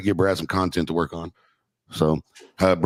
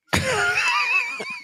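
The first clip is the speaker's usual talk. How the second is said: high shrieking laugh. Real, not acted. Laughs after thanks.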